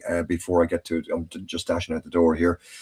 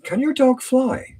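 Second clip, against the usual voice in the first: lightly acted little kid, much higher and slower; small child's question; slower than usual. Little kid vet to a dog owner, innocently curious. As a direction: ask it slowly in a high, childlike voice. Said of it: "says the child".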